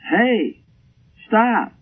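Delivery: mock yell, pitched well up; retold yell. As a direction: call out as if to halt sheep far below. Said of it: "And I yelled".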